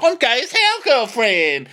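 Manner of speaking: hammed-up camp falsetto; sassy camp reply. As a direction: fling it out like a sassy best friend. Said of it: "he could be like".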